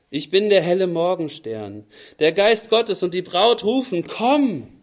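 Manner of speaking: loud, declaiming Bible reading; scripture reading. Acted. Preacher reading Revelation aloud to the church, loud and declaiming. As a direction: proclaim the text to the congregation.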